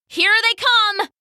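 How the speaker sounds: high, loud battle cry; shouted warning. Acted. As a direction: shout the alarm to the group. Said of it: "shouted Harks".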